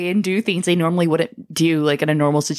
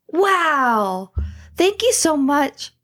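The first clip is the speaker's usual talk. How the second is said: higher, slow and wide-eyed; mock awed boy; 9 semitones higher, much slower than usual. Mocking the boy in a made-up Disney story, pitched up high. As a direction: play up a boy's amazed thanks. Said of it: "he's like".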